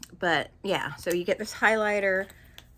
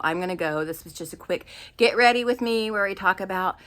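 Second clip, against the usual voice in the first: normal talking voice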